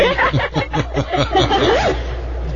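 short burst of high laughter. Real, not acted. Co-hosts cracking up at the joke setup, laughing.

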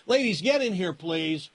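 high call to the room. Real, not acted. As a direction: call the ladies in from next door. Calling ladies into studio.

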